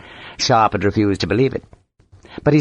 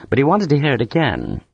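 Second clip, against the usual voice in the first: plain reading voice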